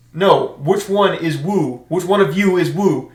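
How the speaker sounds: loud, slow, insistent mock demand; exasperated drill instructor. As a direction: spell it out angrily for recruits. Exasperated kill hat questions recruits.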